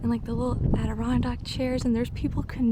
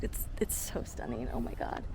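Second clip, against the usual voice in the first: awed whisper; 9 semitones lower, 6 dB quieter than usual. Real, not acted. Awed whisper at lake view.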